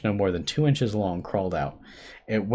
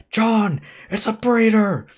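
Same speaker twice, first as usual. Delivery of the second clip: high, loud frantic warning scream; panicked shout to John; 7 dB louder, slower than usual. A narrator's reading. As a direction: yell it across the room in terror. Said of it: "I screamed".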